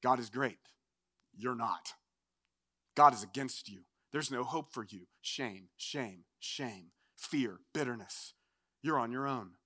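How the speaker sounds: very low, soft accusing voice; condemning tempter. Acted. Father of lies condemning the fallen sinner, low accusing voice. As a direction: intone each accusation low and quietly, pressing the shame in. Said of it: "the same voice that tempts in pride is now gonna condemn you in shame".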